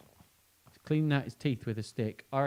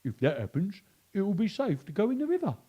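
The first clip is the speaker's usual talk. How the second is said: wild pig; 8 semitones higher, 4 dB louder than usual. Acted. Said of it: "grunted a wild pig".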